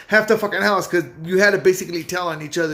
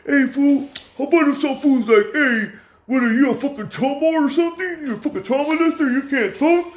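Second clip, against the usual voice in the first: high, mocking tough-guy voice, raised in pitch; mocking homie; 8 semitones higher than usual. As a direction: mimic the taunting homie in a higher voice. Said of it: "there would always be that one homie".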